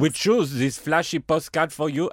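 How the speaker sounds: put-on hammy French accent, brightly lifted greeting. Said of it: "I shall read in my, uh, French accent".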